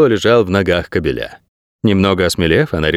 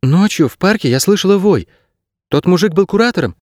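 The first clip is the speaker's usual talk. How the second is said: put-on high young heroine voice; inquiring girl; 9 semitones higher than usual. A narrator's reading. Girl questioning the dog about last night, inquiring. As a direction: piece it together aloud, high-pitched.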